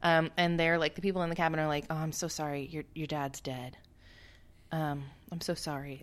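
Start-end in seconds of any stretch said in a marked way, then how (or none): 1.9-6.0 soft, quiet sympathetic murmur; hushed mock condolence. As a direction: console him gently, voice dropped low. Cabin people consoling.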